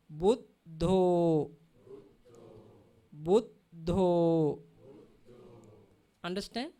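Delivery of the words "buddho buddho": "Buddho" said slowly and a bit louder, with a held d; teacher modelling "Buddho"; careful pronunciation. Shown as a demonstration. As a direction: say "Buddho" as bud-dho, leaning on the double d. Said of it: "At end of the word".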